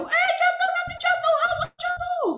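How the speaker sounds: high, loud alarmed shrieks; panicked shouting from a distance; panicked cry. Retold or imitated. Grandmother shouting in panic at climbing child. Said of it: "kita panik dari jauh".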